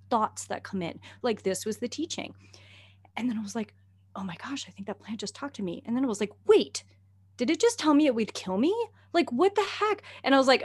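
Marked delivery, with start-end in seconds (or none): 4.2-5.8 hushed awe. Retold or imitated. Awed realization. 6.5-10.0 high, loud alarmed wait; startled as it sinks in; shocked squeak of alarm; alarmed disbelief. Retold or imitated. Alarmed realization.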